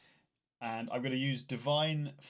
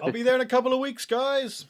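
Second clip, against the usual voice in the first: mock high voice; 11 semitones higher, 8 dB louder, faster than usual. Mocking slow march to the fight.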